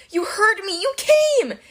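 put-on high, excited goat-boy voice; bleating. A narrator's reading. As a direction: squeal with relief at a rescuer. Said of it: "Grover bleated".

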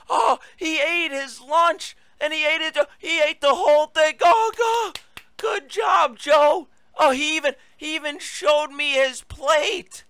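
mocking sing-song praise for a toddler; mock baby talk. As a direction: coo over him like a proud parent with a little kid. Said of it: "treating the president of the United States like he's a toddler".

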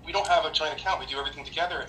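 normal talking voice

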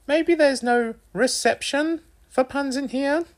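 very high-pitched mother voice; cajoling, joking mum. A narrator's reading. Narrator plays joking mum.

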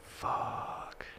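whispered curse. Retold or imitated. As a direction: breathe the curse silently. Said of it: "And I'm like".

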